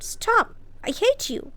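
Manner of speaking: high sharp shout of stop; furious girl shouting, pitch flying up. A narrator's reading. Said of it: "She ran into Colin's room and shouted".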